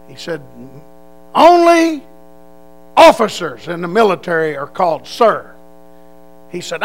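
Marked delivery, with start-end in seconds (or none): 1.3-5.5 drill sergeant. Retold or imitated.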